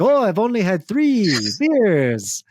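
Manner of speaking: slow, high, lilting Dracula gone Indian; bad Dracula accent. Drunk Tom denying he's drunk, bad Dracula-Indian accent. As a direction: drawl a failed Dracula that slips into Indian accent. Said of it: "I was trying to do Dracula".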